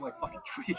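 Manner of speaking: laughing. Real, not acted. Laughing about shivering on camera.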